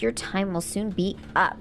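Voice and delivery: normal talking voice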